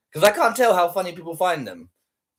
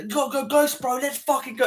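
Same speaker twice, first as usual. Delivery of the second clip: mock gym bro shout; 9 semitones higher than usual. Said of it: "people shouting at each other".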